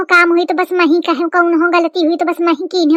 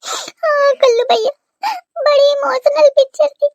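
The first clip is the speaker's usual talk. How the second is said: crying; 6 semitones higher than usual. Acted. Bride tells Kallu bhaiya about the film, crying. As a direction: sob between the words, pitched high. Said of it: "भौजी रोती रहा का".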